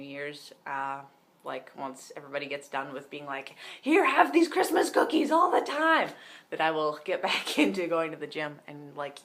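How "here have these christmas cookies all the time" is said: high, loud offer of cookies; hammed-up holiday host pressing treats on everyone; mock pushy relative. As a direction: ham up an aunt shoving cookies at you.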